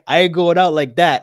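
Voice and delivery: loud, high, defiant vow; defiant self-pep talk. Retold or imitated. Teacher hyping himself up on day one, loud and defiant. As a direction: refuse to be beaten, say it big.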